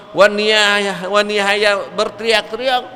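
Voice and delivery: ordinary talk, nothing marked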